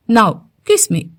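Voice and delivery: kiss me, said firmly; a mild, insistent order; a touch of firm insistence; firm demand. Acted. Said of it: "అని గట్టిగా చెప్పాడు".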